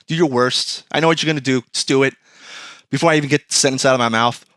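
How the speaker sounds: normal talking voice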